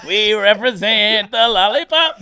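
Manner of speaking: slow, laughing lightly sung Munchkin; a hint of the Lollipop Guild. Quoting Munchkin song, Lollipop Guild.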